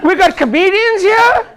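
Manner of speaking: hammed-up surprise; mock astonishment. Real, not acted. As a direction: feign amazement at the laughing crowd. Mock surprise at crowd laughter.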